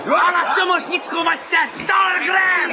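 high combative shout; angry accusation. Acted. Kotik accusing Talgren during the fight, angry shout.

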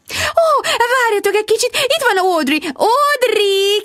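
nagyon magas, hívogató hang; kiálts oda magasan, szórakozottan; odakiáltás. A narrator's reading. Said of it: "kiáltott oda se figyelve". (magas hangú, hívogató kiáltás)

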